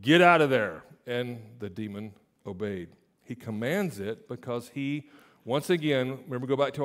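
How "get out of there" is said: loud sharp order; commanding. Acted. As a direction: bark it out with authority. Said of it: "He just says".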